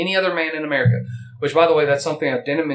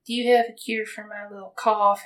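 customer voice; 9 semitones higher than usual. Acted. Acted bookstore customer asking.

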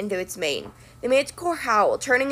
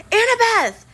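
high, louder yell; yelled name; 12 semitones higher, 5 dB louder than usual. A narrator's reading. Percy yelling after Annabeth as she falls, yelling. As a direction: call after her desperately. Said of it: "I yelled".

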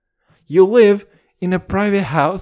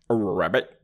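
frog ribbit; 9 semitones lower, 3 dB quieter than usual. Pretend. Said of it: "that's a frog sign".

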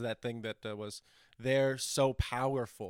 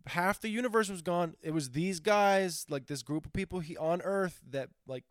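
higher, breezy mock awe; imitating Salma Hayek's character; 9 semitones higher than usual. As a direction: gush about humans like a laid-back alien. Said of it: "Salma Hayek's character's like".

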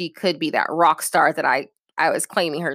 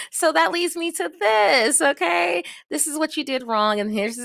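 ordinary talk

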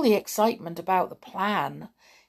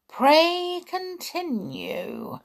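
high, drawn-out mock politeness; sarcastic; 9 semitones higher, much slower than usual. A narrator's reading. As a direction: invite him on with slow sarcasm. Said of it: "a sarcastic tone in her voice".

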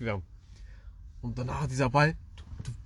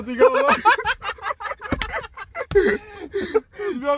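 real laughter breaking through high; laughing too hard to go on; cracking up; 7 dB louder, much slower than usual. Real, not acted. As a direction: lose it mid-story, voice shooting up. Cracks up telling the funny memory.

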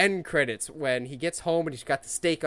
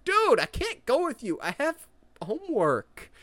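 put-on whiny teen; 10 semitones higher than usual. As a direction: beg off like a kid with homework. Imitating small-stakes Peter.

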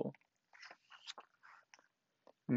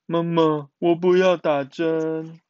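put-on slow, louder childlike protest; insistent; 3 semitones higher, 6 dB louder than usual. A narrator's reading. Miaomiao telling mother no injection, slow and insistent. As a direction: insist on it, drawing the words out. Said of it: "再一次的强调".

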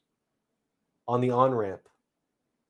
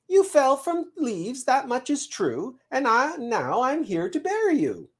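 very high squirrel voice; brisk squirrel messenger; 12 semitones higher than usual. Acted. Squirrel tells the seed he has come to bury it, chirpy.

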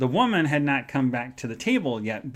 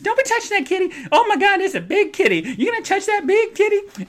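very high, louder camp squeal; camp falsetto; 4 dB louder than usual. Retold or imitated. Man in next cubicle teasing, high camp falsetto. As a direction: ham up the scandalized squeal.

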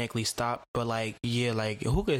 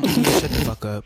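loud; 9 semitones higher, 8 dB louder, much faster than usual. Real, not acted. Ranting at people who confuse vaccines.

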